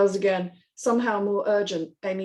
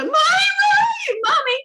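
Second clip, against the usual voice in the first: put-on high, loud terrified child's scream; 8 dB louder than usual. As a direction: scream for mommy in panic. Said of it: "screams louder".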